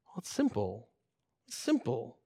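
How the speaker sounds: low, hushed and simple; quiet answer. Real, not acted. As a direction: drop right down to answer the objection softly. Soft answer to own loud objection.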